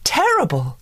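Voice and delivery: high, worried fairy; a hint of alarm, high; lightly voiced fretful fairy. A narrator's reading. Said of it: "said Fauna".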